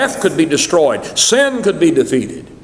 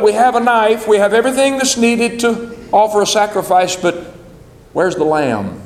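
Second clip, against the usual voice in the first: put-on young Isaac's question; 5 semitones higher than usual. Isaac asking his father where the lamb is, puzzled and pleading. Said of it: "and he says".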